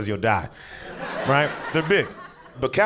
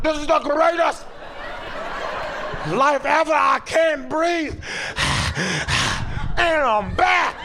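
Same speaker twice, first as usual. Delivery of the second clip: high, slow, breathless dog voice; overjoyed dog at a car window; ecstatic dog; 13 semitones higher, slower than usual. Acted. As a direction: gasp in pure joy like a dog in the wind.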